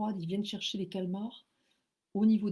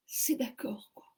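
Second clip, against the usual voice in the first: whispered disbelief; 3 semitones higher than usual. Real, not acted. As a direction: breathe it in amazement. (chuchoté, incrédule, « c'est d'accord »)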